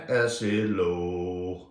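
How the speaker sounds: ACID LOW sunk to a low, long note; low and drawn-out. Shown as a demonstration. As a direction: drop down and stretch "low". Drawn-out low versus high.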